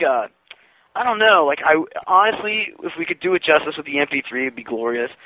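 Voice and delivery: usual speaking voice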